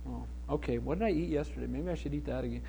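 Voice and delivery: very soft, low thinking aloud; hushed self-talk. Retold or imitated. Traveler wondering what he ate yesterday, in hushed self-talk. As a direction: drop right down, as if pondering privately. Retold private thoughts about his stomach.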